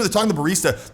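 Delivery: usual speaking voice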